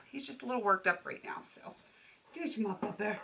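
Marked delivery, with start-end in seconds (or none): 2.3-3.1 soft coo. Real, not acted. Cooing at puppy, soft.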